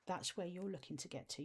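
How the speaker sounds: ordinary talk, nothing marked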